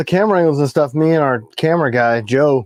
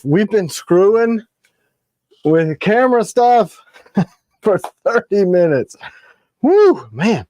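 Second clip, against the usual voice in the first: exasperated; 6 semitones higher, slower than usual. Real, not acted. Exasperated about camera trouble.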